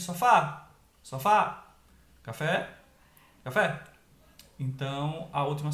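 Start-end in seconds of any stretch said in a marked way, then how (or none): 0.0-1.7 SOFÁ called loudly to the next room; calling out. Shown as a demonstration. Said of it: "Pronuncia a palavra como se estivesse chamando ela". 2.2-3.8 CAFÉ called with a punched final syllable; calling out. Shown as a demonstration. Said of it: "Pronuncia a palavra como se estivesse chamando ela".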